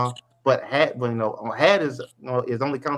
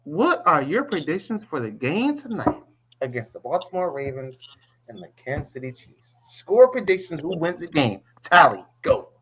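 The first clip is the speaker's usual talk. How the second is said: drawn-out, swooping game-show delivery; show host announcement; 4 semitones higher than usual. Acted. Host calling for tonight's score predictions, showy announcer delivery. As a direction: announce the segment with showy, stretched emphasis.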